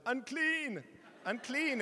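high, loud mock warning cry; imitated shout. Brother-in-law with covid warning the household, shouting. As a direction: shout it like a playful leper imitation. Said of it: "he shouted".

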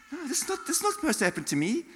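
mock indignant protest. Acting out his protest at malaria.

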